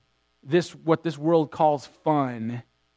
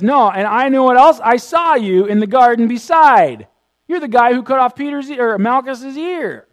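high, loud, insistent call-out; acted accuser with raised voice; loud accusation; 8 semitones higher, 10 dB louder than usual. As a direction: shout him down as an eyewitness. Acting out another accuser of Peter.

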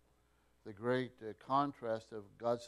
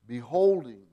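Beholding, said much louder and falling; stress on beholding; 15 dB louder than usual. Shown as a demonstration. Teacher to class, hammering home the word Beholding. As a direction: land hard on Beholding as the key word. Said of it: "let's just think about the emphasis of these words".

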